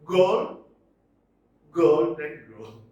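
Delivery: GOLD isolated loud, then golden grove; drawn-out GOLD, lighter ending; stress on GOLD. Shown as a demonstration. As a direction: punch the first syllable of golden, slowly. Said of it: "Stress is only on the first syllable".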